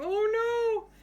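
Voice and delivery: high, loud mock fright; frightened girls. Teacher voices the scared girls seeing the mice, high and loud. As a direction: squeal it in pretend alarm. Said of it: "all these friends all over here going".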